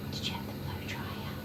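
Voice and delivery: very soft whispered question; soothing whisper. Real, not acted. Groomer asking the dog about the blow dryer, soothing whisper.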